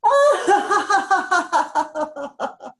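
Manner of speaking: high rising laughter; released laugh. Shown as a demonstration. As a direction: let a worry go with a laugh. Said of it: "release one more".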